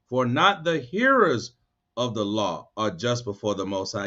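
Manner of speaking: slow on For not the hearers, then dropping low. Shown as a demonstration. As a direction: reread it slowly so they listen. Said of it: "I'm gonna read it again".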